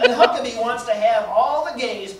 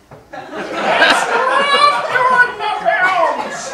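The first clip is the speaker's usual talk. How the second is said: a laughing high strained Stallone, much louder and very slow; Stallone impression; 9 dB louder, much slower than usual. Doing Stallone for the crowd, high, loud and laughing. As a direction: stretch it out, high and loud, cracking up. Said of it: "That's my Stallone".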